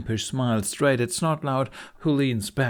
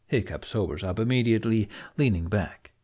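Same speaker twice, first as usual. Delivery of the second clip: ordinary delivery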